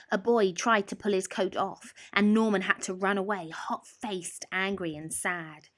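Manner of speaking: ordinary delivery, nothing marked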